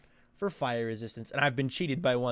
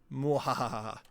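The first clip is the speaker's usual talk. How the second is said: evil cackle; villainous mock mwahaha; mad scientist laugh; slower than usual. Sending viewers to Nether Viking.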